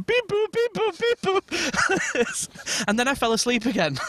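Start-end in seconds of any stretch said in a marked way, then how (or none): 0.0-2.8 very high beep boop noises; mock keypad beeps.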